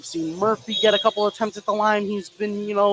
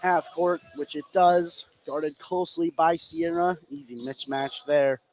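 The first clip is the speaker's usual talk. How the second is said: live basketball call, building as the shot goes up; play-by-play; 4 dB louder than usual. Real, not acted. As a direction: call the action as it happens.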